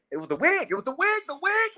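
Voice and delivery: mock astonished reveal; rising excitement. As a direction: build to a squeal on wig. Building reveal of talking wig.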